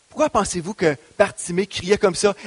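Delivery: normal talking voice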